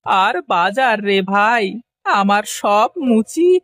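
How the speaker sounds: slow, drawn-out wail; hammed-up crying; tearful lament. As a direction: sob out the bad news, stretching the endings. Ruined potter laments to friend.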